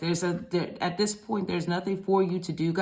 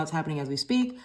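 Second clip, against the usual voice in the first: usual speaking voice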